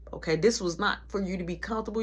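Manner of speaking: ordinary delivery, nothing marked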